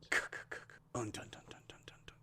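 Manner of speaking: whispered «C-, c-, un-, dun, dun» fading away; sounding out words. Shown as a demonstration. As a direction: stutter «c-» and «un-», then fade «dun» to a whisper. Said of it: "Sound it out".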